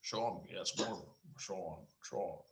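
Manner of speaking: low, hushed actor impression; soft, low impersonation attempt; Sean Connery. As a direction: practise a famous actor's voice under your breath. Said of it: "Can you do a Sean Connery impersonation?".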